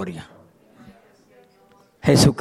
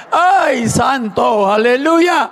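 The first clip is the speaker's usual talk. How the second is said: excited exclamation; 7 semitones higher, much slower than usual. Real, not acted. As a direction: burst out praising, half laughing. Exclaims after laughing.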